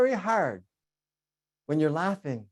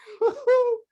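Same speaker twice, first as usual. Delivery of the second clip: laugh; 4 dB louder than usual. Real, not acted. Laughs after his dance.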